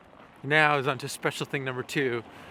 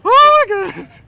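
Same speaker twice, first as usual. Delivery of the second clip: excited shout; 15 dB louder than usual. Real, not acted. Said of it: "We're so pumped up!".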